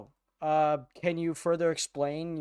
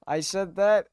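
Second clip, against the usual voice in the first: higher, louder, breaking into laughter; real incredulous laughing; laughing disbelief; 6 semitones higher, 6 dB louder than usual. Real, not acted. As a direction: crack up at your own words. Laughing disbelief at own speech.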